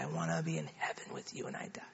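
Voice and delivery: very low, soft, quick repeat; retold prayer fading almost to nothing; child echoing prayer. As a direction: imitate a child's hushed, hurried echo. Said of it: "You just pray after me".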